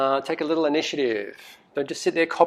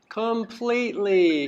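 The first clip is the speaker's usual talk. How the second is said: Completely drawn out slowly and pitched higher; stress on completely; 5 semitones higher, slower than usual. Shown as a demonstration. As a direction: lean on Completely, slow and raised. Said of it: "I wanna emphasize this word here".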